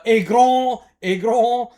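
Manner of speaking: loud, high, mock French shout. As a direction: yell fake French phrases. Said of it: "he would shout out".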